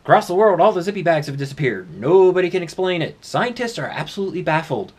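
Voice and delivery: mock news report. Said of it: "news reports would come in".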